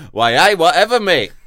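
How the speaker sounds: loud, high Geordie why aye; hammed-up Geordie accent, loud and high. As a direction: shout it like a cheery Geordie. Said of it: "You don't say "why, aye" in Sunderland".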